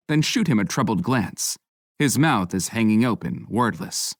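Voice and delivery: usual speaking voice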